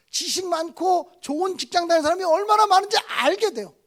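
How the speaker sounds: ordinary talk, nothing marked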